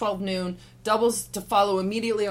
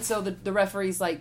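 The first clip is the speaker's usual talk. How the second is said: normal talking voice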